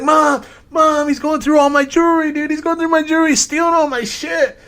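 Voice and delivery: very high, loud imitation of a screaming son; screaming for mom. Son accusing the inspector to his mom, screaming. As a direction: yell the accusation shrilly up high, as if hollering downstairs. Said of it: "He starts screaming".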